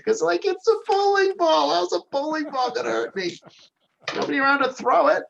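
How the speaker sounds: high-pitched comic disbelief; mock incredulity. Player excusing his missed danger sense, mock incredulity. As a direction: argue it in a high, baffled voice. Voices why danger went unsensed.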